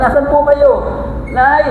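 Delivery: put-on high, loud child calling for mother; pasigaw na tawag. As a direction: call out, searching the house. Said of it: "sigaw siya". (pasigaw na hanap sa nanay)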